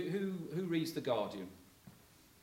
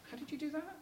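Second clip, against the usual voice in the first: amazed dad; 14 semitones higher, 6 dB quieter, faster than usual. Retold or imitated. Father amazed, voiced.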